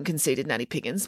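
ordinary delivery, nothing marked

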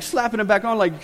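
ordinary talk

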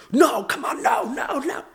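high yelling no at the TV; imitating a football fan shouting at the screen; mock fan yell. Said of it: "just yell".

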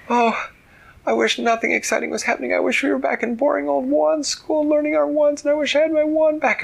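very high, fast, frantic babble; panicked girl. Acted. Claire makes her wish in the dragon's grip, panicked. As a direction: blurt it all out in a high panic.

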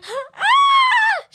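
very high-pitched mimicked shriek; imitated startled scream. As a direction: imitate her yelling in fright. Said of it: "She really yelled, like, really loud".

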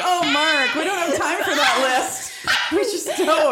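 high, laughing mock reply; teasing through laughter. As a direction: tease a husband while cracking up. Jokey reply amid laughter.

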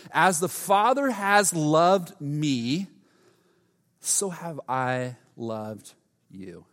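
slow, deliberate scripture reading; solemn reading. Real, not acted. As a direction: let each word land.

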